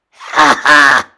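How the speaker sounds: loud troll shout of triumph; bellowing trolls. A narrator's reading. Twin trolls pouncing on Toofy, bellowing laugh. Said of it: "they bellowed together".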